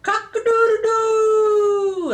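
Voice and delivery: high, loud, long-held crowing; rooster crow. Retold or imitated. Grandma showing roosters up early on a farm, crowing. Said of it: "and they go".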